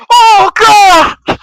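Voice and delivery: loud, very high cry of pain; pained scream. Retold or imitated. Wrestler screaming after being clotheslined, very loud and high. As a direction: scream like you just took a forearm to the throat. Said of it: "And all I hear is".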